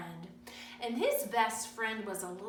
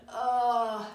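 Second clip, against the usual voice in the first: tummy ache groan; 7 dB louder than usual. Retold or imitated. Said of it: "having a tummy ache and saying".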